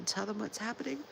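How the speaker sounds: usual speaking voice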